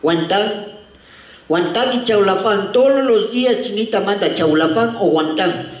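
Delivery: put-on slow, sing-song Chinese-accented Spanish; mock Chinese accent. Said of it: "El chinito abría y decía".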